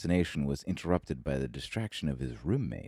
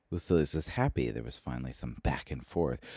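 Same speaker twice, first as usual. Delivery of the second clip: plain reading voice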